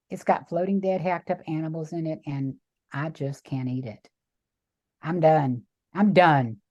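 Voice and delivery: low, resolved statement of finality; low, self-directed refusal; quiet resolve. Retold or imitated. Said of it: "in such a way that I wasn't trying to convince her".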